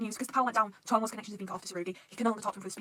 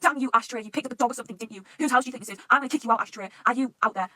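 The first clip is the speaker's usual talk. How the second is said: mock angry yell, raised in bursts; imitated screaming; 3 semitones higher, 8 dB louder than usual. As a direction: half-shout it as a scolding abuser. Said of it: "you hear screaming like".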